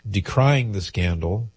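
ordinary delivery

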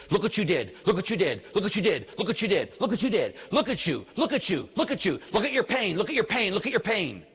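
relentless jabbing repetition, each phrase hit alike; insistent sing-song taunt that keeps prodding; poking, needling devil. Retold or imitated. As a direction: imitate a nagging accuser, drumming it in. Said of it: "kept poking and poking and poking".

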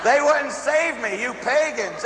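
high, drawn-out joking complaint; mock outrage. Priest teasing parishioners who wouldn't save him, mock outrage. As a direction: scold them in high-pitched fun through the laughter.

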